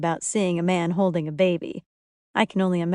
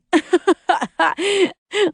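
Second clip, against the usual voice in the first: loud, high burst of laughter; helpless fit of laughing; uncontrollable laughter; 12 semitones higher, 6 dB louder than usual. A narrator's reading. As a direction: crack up at someone's fright. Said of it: "Danny can't stop laughing".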